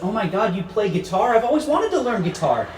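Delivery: gushing, high-pitched fan; excited passenger. Acted. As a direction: get carried away with delighted enthusiasm. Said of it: "her eyes lit up and she looked excited".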